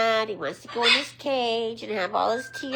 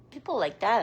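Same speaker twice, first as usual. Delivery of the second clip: ordinary talk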